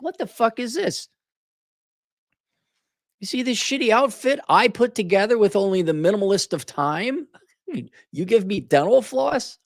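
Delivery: high-pitched, affronted disbelief; indignant trick-or-treater. Acted. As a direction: play a kid outraged by a lousy treat. Voices child given dental floss.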